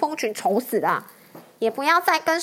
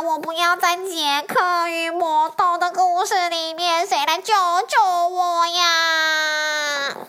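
slow, higher, tearful cries for help; crying for help; 4 semitones higher, slower than usual. Acted. The dragon begs for rescue from the giant, wailing. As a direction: wail like a terrified little dragon. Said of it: "龙大声说".

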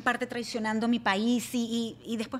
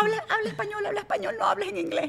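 very high imitation of embarrassed kids; high-pitched, insistent kid voice; nagging children; 12 semitones higher than usual. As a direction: mimic a child pleading with mom. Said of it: "Me dicen".

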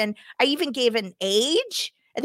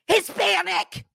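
higher, lightly voiced know-it-all Karen; mock Karen; 8 semitones higher than usual. Teller mocking a Karen answering the 911 operator, higher mock voice. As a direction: play a sure-of-herself Karen, only lightly. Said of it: "that Karen that's".

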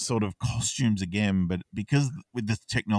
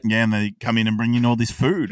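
ordinary delivery

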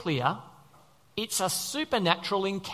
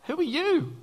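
high-pitched shocked question; acted astonishment, pitch jumping up; startled Saul; 8 semitones higher than usual. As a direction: ask it high, as if taken aback. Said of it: "Saul goes".

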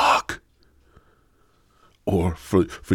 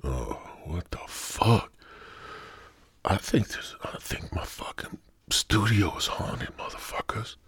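half-joking frightened whisper about a haunted studio; scared whisper; 8 dB quieter than usual. Real, not acted. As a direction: whisper as if a ghost might hear you.

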